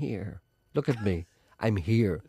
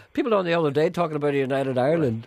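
normal talking voice

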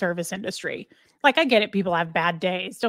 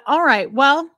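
normal talking voice

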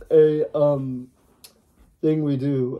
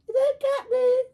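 squeaky high character voice; high cartoon voice. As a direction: pitch way up, cartoonish.

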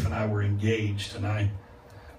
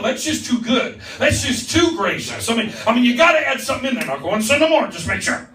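loud, high, fired-up preaching; preaching at full force; 12 semitones higher, 8 dB louder than usual. Acted. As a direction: preach it hard to the room, laughing at the translators.

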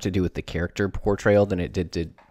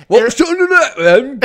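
squeaky, much higher and louder British caricature; mock British accent; 14 dB louder, slower than usual. Co-host joining the bit with a high, squeaky mock British accent. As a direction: play along with a silly British voice.

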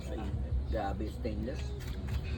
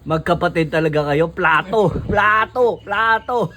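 excited teasing; 8 dB louder than usual. Real, not acted. Teasing friends eating from plates.

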